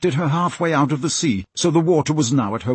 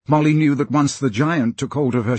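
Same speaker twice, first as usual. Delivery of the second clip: ordinary delivery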